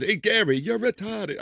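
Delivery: high mocking taunt; Howard impression. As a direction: tease like a radio host. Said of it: "when Howard get into his".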